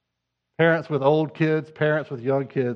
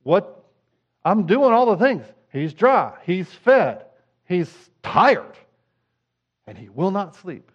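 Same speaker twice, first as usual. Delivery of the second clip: retold crying out; 3 semitones higher, 3 dB louder, slower than usual. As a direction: mock-desperate plea, pitch leaping higher. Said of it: "literally crying, going".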